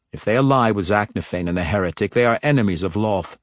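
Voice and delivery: ordinary delivery, nothing marked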